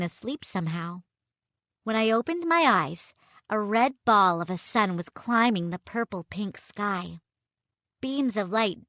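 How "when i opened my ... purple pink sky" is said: ordinary delivery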